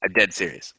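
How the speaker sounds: ordinary delivery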